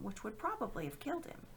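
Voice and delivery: usual speaking voice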